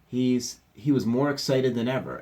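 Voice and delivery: usual speaking voice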